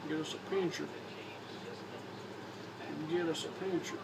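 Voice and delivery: ordinary talk, nothing marked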